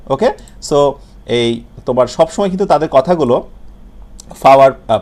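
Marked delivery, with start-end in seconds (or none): 4.4-4.7 "ফাওয়ার" with a loud puff on the P; aspirated P. Retold or imitated. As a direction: hit the aspirated start of "ফাওয়ার". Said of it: "পিটাকে তারা ফয়ের মতো করে". (জোরে মহাপ্রাণ ফ দিয়ে "ফাওয়ার")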